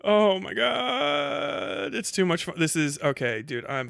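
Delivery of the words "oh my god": ordinary talk, nothing marked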